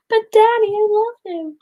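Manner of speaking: high, hammed-up film-princess plea; pleading daughter. Daughter quoting Ariel to her dad, mock pleading. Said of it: "If I said that to my dad".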